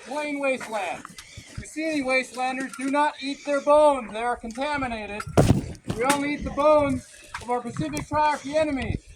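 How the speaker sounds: loud commanding drill sergeant. Acted. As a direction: bark orders at the troops. Commander briefs troops in character.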